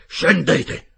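high, very loud order; commanding shout. Acted. Captain ordering the narrator below, commanding shout. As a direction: shout the command, brooking no argument.

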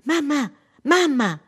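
high, loud, drawn-out yell; child's shout. A narrator's reading. Daughter shouting to mother, high urgent cry. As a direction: cry out for mum like a little girl. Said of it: "gridò la figlia".

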